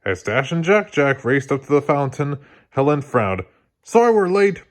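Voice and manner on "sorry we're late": higher, louder quick blurt; eager kid's blurted apology. A narrator's reading. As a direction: blurt it out before anyone speaks. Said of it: "blurted Dash".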